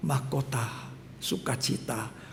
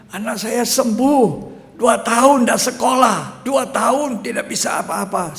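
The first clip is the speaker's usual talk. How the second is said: joyful mother; 6 semitones higher, 6 dB louder than usual. Retold or imitated. Mother telling the pastor her son is healed, overjoyed. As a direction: proclaim the healing with delight. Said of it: "Mamanya bilang".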